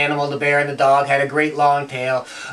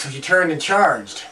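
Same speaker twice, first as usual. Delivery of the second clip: ordinary delivery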